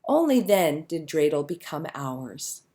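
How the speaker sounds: ordinary delivery, nothing marked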